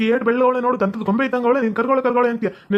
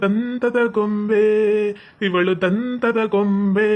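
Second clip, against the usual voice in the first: very slow, drawn-out praise; lovestruck, lingering on every syllable; smitten admiration; much slower than usual. Acted. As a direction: stretch each word dreamily. Smitten man admires her fair looks.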